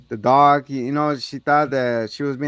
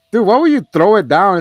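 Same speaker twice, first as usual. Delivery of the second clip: loud incredulous outburst; 7 semitones higher, 11 dB louder than usual. Real, not acted. Exclaiming at the scene.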